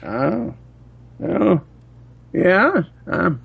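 put-on high, slow, quavering old man. Old man answering a telemarketer, high and slow. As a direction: play a confused grandpa, dragging it out. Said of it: "your old person telemarketer voice".

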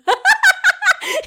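high, loud cackle; high burst of laughter. Real, not acted. Teased by her friend, high burst of laughter. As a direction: crack up hard.

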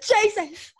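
loud, high startled yelp; hammed-up shock; mock fright. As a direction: yelp as if jolted awake in horror. Imagined waking up beside scary teddy.

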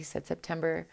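ordinary delivery, nothing marked

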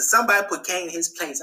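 normal talking voice

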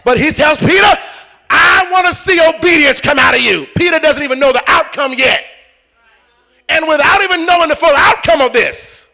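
loud, driving preacher's shout; full-force preaching. Acted. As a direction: preach it home to the congregation.

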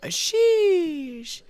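very high, loud, drawn-out squeal; kids' slang sheesh. Acted. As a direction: stretch it out way up high like the kids. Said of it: "what do the kids say now".

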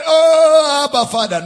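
a whimpering, pleading "Oh, Abba, Father"; mock weepy plea. Shown as a demonstration. Preacher mocks a tearful cry, whining. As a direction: wail it like a weak, sad plea. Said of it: "No, it means to shout out an overwhelming tone".

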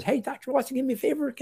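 high-pitched mock nurse voice; voicing eager nurses. Nurses asking the doctor for favors.

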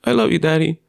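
lightly voiced young son, affectionate; imitating his son. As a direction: son running up to his dad, gently voiced child. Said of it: "running up to me saying".

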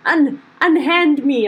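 put-on posh drunk, drawn out; drunken, posh goblin. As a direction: grandly protest while drunk. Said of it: "very drunkenly saying to Amandla".

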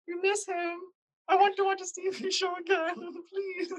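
high-pitched put-on pleading voice; silly character voice. Co-host playing the mom missing the singer, silly high voice. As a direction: plead in a squeaky, hammed-up character voice. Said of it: "These voices are so bad".

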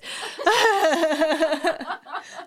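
bright, high burst of laughter. Real, not acted. Laughing after a tongue twister.

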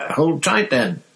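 high, lively Cockney bus conductor; bright, high cheeky lad; Stan's chirpy voice. Acted. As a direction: chirp it out like a cheery young conductor. Stan voiced.

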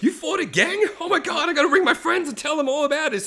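put-on high, thrilled child voice; excited kid. Kid to dad about the gang fight, high excited voice. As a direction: gush in a high-pitched kid's voice. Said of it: "the kid's like".

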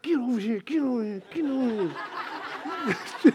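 quietly imitating a wife's repeated summons; imitated wife. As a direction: lightly voice a wife calling under her breath. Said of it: "my wife's like".

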